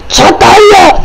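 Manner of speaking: high, loud furious burst; exploding in anger. Acted. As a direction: blow up at the dog. Says his nerves are shot.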